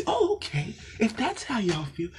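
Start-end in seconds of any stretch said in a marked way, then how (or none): 0.1-0.8 high mocking oh okay; sarcastic okay. Real, not acted. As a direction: fake realization, pitched up.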